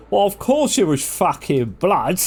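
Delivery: high, drawn-out mock outburst; hammed-up exasperation, slow and high; exasperated retort. Player answering about blood at the fort, mock exasperation.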